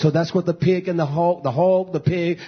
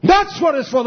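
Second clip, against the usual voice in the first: much higher and louder, a full preacher's cry; preaching shout; 6 dB louder than usual. Acted. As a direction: thunder it out to the congregation. Preacher repeats line, then shouts it.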